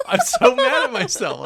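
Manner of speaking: laughing. Real, not acted. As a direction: laugh at your own blunder while talking. Host laughs at forgetting soundbite.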